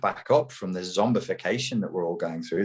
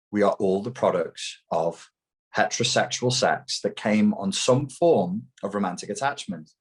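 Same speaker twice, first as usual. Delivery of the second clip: usual speaking voice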